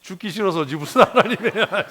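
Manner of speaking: loud and high, laughing through the words; laughing outburst. Real, not acted. Preacher to congregation, blunt truth spoken through laughter. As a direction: blurt it out while laughing at yourself. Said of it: "blunt punchline to the congregation".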